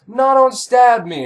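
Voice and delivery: hammed-up plea for her life; mock pleading. Mother begging her son not to stab her, hammed-up. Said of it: "she's like".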